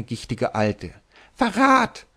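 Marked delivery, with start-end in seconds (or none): 1.3-2.0 a very high piercing shriek of treason. Acted. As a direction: screech it like a furious duchess. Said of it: "kreischte die Erzherzogin Anna Pest".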